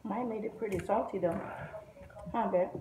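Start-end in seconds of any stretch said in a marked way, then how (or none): none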